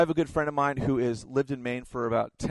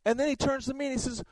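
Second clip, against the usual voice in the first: ordinary delivery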